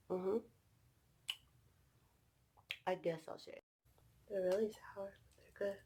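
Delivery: soft whisper with candy in mouth; hushed, confiding whisper to the camera. Real, not acted. Whispers to viewers while eating.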